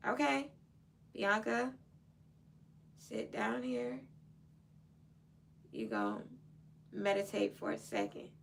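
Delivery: gently calming herself down; self-calming. Retold or imitated. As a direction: coax yourself to sit and breathe. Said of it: "meditation music".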